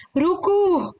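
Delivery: high, drawn-out pigeon coo; dove coo. A narrator's reading. Dove at the men's feet, cooing high. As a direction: coo it like a dove, pitched well up. Narrator voices the dove's coo.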